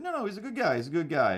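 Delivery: high mock dad insisting; lightly voiced dad, dismissive; imitating dad. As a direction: brush it off like a stubborn dad. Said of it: "my dad was like".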